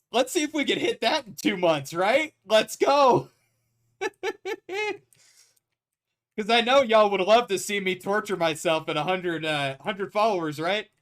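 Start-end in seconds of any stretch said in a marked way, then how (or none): 4.0-4.9 sudden high squeaky laugh; high-pitched giggle. Real, not acted. Burst of laughter after hype.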